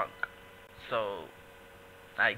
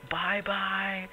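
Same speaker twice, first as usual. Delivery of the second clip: singsong bye-bye; 8 semitones higher than usual. Retold or imitated. Drunk man waving off.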